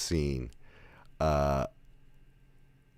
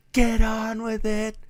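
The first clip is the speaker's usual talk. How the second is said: high, loud falsetto yell, drawn out; mock-shout at a dragging movie; impatient heckle; 5 dB louder, slower than usual. Viewer's impatient heckle at screen.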